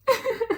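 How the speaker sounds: a sudden high laugh bursts out; high burst of laughter. Real, not acted. Laughing out loud at how she told the waiter off. Laughing at her own retort.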